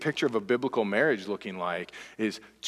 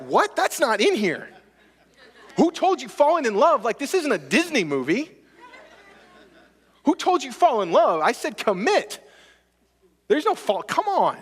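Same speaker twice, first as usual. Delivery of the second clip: higher, louder voice of Jesus in disbelief; Jesus scolding a believer, half-laughing; Jesus incredulous; 12 semitones higher, 5 dB louder than usual. Retold or imitated. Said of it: "Jesus is like".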